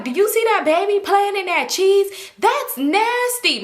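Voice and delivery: put-on high, slow, drawn-out kid voice; Cheryl. Little girl disgusted at baby in the cheese, high mimicry. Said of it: "She like".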